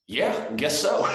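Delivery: high, loud retold reply; startled agreement. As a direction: blurt a startled yes, reenacting it. Acting out his surprised reply.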